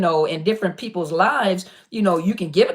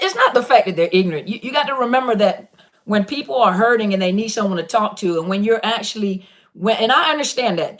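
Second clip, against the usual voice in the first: ordinary talk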